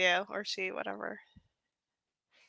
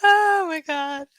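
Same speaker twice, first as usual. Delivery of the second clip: laughing; 5 semitones higher, much slower than usual. Real, not acted. Laughing at absurd clip.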